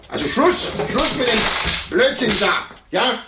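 very high, loud shrieking rage; furious outburst. Acted. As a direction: blow up and end the game. Sore loser ends chess game.

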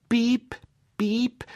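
very high, loud little peeps; chick peeping. Acted. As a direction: squeak like a newborn duckling. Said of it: "sagte das Junge".

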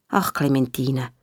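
low, slow motherly sigh of sympathy; tender consolation. Acted. Mother hugging her upset child, tender and slow. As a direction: soothe the child gently.

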